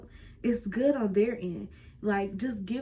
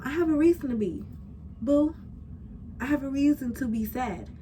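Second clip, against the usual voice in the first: indignant pushback; 6 semitones higher than usual. Real, not acted. Pushing back at the critic.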